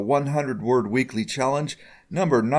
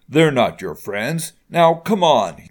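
raised voice, building to an order; slow insistence, then louder on the order; much louder; 2 semitones higher, 5 dB louder than usual. A narrator's reading. Father insists his daughter leave, getting louder. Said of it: "he said, much louder".

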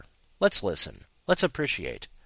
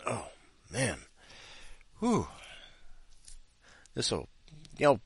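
ordinary talk

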